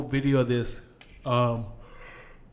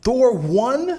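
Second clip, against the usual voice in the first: loud, high, incredulous question; real disbelief, pitched way up; 12 semitones higher, 11 dB louder than usual. Real, not acted. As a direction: shoot it back in loud disbelief. Disputes that Thor one was funny.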